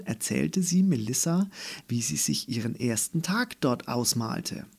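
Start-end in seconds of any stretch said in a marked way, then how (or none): none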